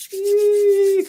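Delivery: very high loud yee squeal; a sudden high whoop; high excited squeal. Real, not acted. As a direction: let out a happy squeal. Excited squeal before congratulations.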